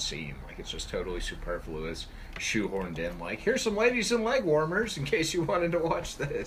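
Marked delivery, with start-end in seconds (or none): 3.4-6.5 high, loud mock showcase voice; mock presenting. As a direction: present it like a silly sales pitch. Mocking the filmmakers' shoehorned scene.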